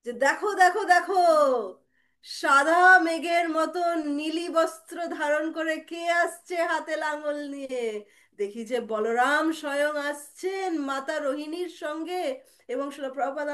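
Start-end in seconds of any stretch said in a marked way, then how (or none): 0.2-12.2 drawn-out, raised storyteller's call; guru pointing out. Retold or imitated. Guru showing disciples who is coming, slow wondering call. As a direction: announce the sight slowly, stretching the words. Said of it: "শিলা প্রভুপাদ এমনি করে দেখাবেন".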